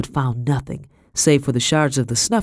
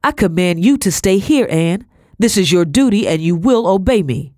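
louder, higher, forceful orders; angry command; 4 semitones higher, 5 dB louder than usual. A narrator's reading. Husband ordering his struck wife to obey, angry command. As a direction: lay down the law forcefully.

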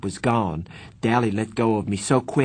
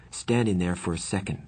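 usual speaking voice

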